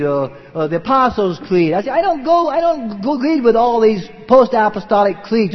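loud, high-pitched, emphatic sermon delivery; forceful preaching. Real, not acted. Preacher rejecting church councils before his congregation, forceful and defiant. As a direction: preach it hard, defying the creeds.

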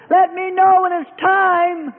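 high, whiny pleading voice; high whining plea to the Lord; impatient believer. Acted. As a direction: nag like a child in the fire.

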